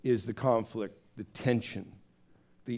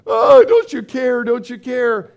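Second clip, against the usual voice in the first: much higher, louder frantic wail; desperate plea; 9 semitones higher, 11 dB louder than usual. Retold or imitated. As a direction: cry out at him in mock alarm. Disciples wake sleeping Jesus.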